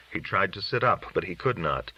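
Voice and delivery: ordinary delivery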